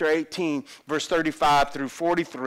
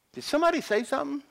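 high, quick puzzled query; oblivious question; 10 semitones higher, much faster than usual. Retold or imitated. Father who doesn't hear well, oblivious. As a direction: play the dad who barely heard. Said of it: "I don't hear well".

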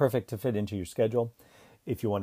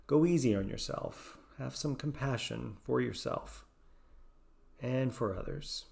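guided meditation; 7 dB quieter than usual. Acted. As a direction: ease them gently into calm and self-kindness. Leads a guided self-compassion meditation.

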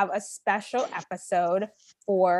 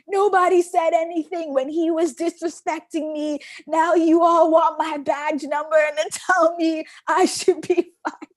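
a mild mock yell, pitched higher; retold screaming; 8 semitones higher, 3 dB louder than usual. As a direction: imitate the driver shouting into his mirror. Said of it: "he starts screaming at us".

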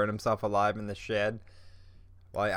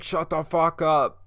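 higher, louder mock snap; mock angry friend; 7 semitones higher, 6 dB louder, slower than usual. Friend snapping at him over the joke, mock angry. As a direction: play the friend getting mad. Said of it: "And he's like".

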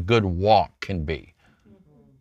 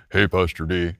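put-on lower, deeper voice of the same kid now grown; 6 semitones lower than usual. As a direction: drop it low like the boy whose voice has broken. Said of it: "he called me on the phone and goes".

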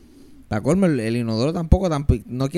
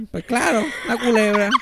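resigned sarcasm; 7 semitones higher than usual. Retold or imitated. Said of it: "ni sorprendido ya".

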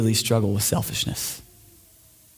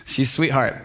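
usual speaking voice

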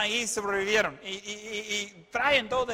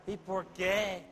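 slow, softer mock wail; mock tearful; 6 dB quieter, much slower than usual. Sara hearing Lot must leave, mock tearful. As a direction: drag it out as a mild tearful complaint. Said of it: "ella comienza a llorar".

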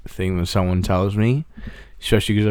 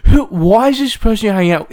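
mock angry mum; 12 semitones higher, 5 dB louder than usual. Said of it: "she's like".

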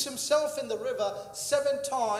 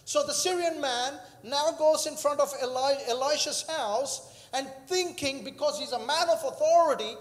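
ordinary delivery

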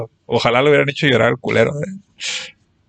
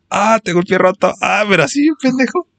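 hammed-up pain; 6 semitones higher than usual. Classmate fakes a broken foot in silent class, hammed-up high moans. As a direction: ham up a fake broken foot, whining high.

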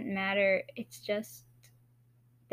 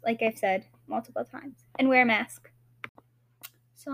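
usual speaking voice

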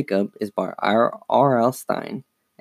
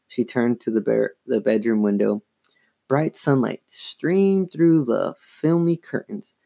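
ordinary delivery, nothing marked

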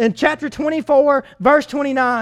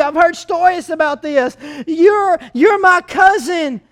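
higher and louder, a thrilled Rachel realizing; excited recognition; 5 semitones higher, 4 dB louder than usual. Retold or imitated. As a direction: burst out as the delighted cousin. Rachel voiced recognizing Jacob.

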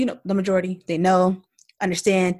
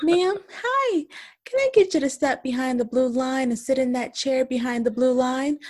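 sweet customer voice; 6 semitones higher than usual. Retold or imitated. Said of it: "I'm like".

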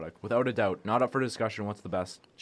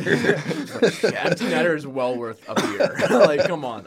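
high, loud laughter through words; laughing; 5 semitones higher, 8 dB louder, slower than usual. Real, not acted. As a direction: laugh while you talk. Laughing hard.

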